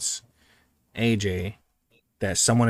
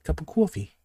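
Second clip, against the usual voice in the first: put-on Boston accent; 3 semitones higher, faster than usual. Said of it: "the Boston accent".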